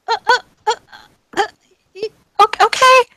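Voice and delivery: high-pitched panicked stutter; frightened stammer. Acted. Receptionist panicking at intruders.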